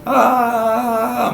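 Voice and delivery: long, high, held "aaah"; mock a little kid drowning someone out; child's blocking-out scream. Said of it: "Não lembra do menininho, de uma criança".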